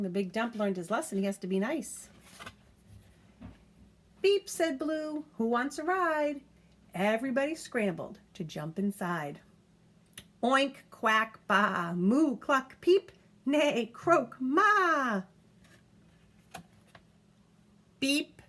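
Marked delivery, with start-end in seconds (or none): 4.2-15.2 lively picture-book reading; reading to a child. A narrator's reading. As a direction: read it aloud to a toddler, bouncy and clear. Reads picture book aloud.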